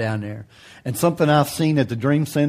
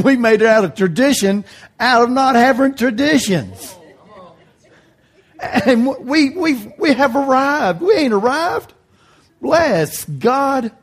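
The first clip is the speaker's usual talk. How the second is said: higher, hammed-up self-congratulation; mock proud; 7 semitones higher than usual. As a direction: parody the boast, pitch climbing. Mocking charismatics' pride.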